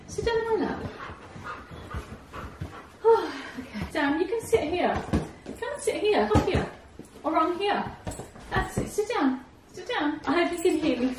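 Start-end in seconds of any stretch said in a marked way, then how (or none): 0.1-0.8 higher, soft dog talk; coaxing the dog. Real, not acted. Owner coaxes her whippet onto her lap, higher and soft. 5.6-10.2 higher dog-directed coax. Real, not acted. Owner coaxes young whippet Sam to sit, higher.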